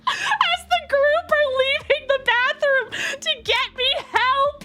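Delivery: high, squeaky, near-tearful laughing voice; cracking up while telling it; talking through laughter. Real, not acted. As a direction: barely get the story out for laughing. Laughs helplessly telling the story.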